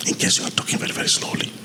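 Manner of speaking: soft, breathy test take; quiet phrase for the compressor; quiet demo. Shown as a demonstration. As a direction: speak it low to test the boost. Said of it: "when you increase this one, now that slow part will come up".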